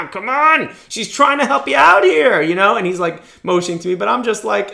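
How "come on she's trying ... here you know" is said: higher, louder exasperated nudge; urging father. Retold or imitated. Father in court urging son to accept judge's help, exasperated. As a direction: push your son to take the hint.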